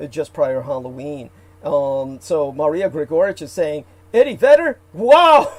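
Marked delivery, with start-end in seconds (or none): 4.1-5.5 reading the comment out high and loud, laughing; excited read. Acted. Host reads out a viewer's comment, excited and laughing. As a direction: mimic the viewer's delight with a laugh.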